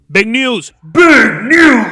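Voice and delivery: loud, high mock fanfare; hyped announcement. Vlogger hyping his big news, shouted announcement. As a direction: build to a hammed-up blast.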